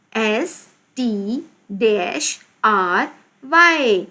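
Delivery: slow, drawn-out letter names; spelling out. Shown as a demonstration. Teacher spelling with a blank. (धीरे-धीरे, खींचकर हिज्जे)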